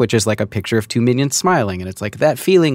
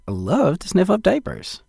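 high-pitched enthusiastic endorsement; oversold enthusiasm; 9 semitones higher, 3 dB quieter than usual. Shown as a demonstration. Host selling a diaper line to producer, enthusiastic. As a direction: sell it hard, pitched up high. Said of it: "You don't think I oversold it?".

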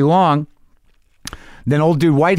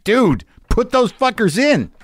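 eager urging; 10 semitones higher, slower than usual. Retold or imitated. Urging the service elf.

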